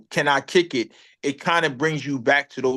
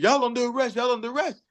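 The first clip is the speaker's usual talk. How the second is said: mock security guard; 8 semitones higher, faster than usual. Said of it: "the guy was like".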